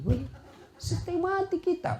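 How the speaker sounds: mock suffering husband. Husband's exaggerated misery.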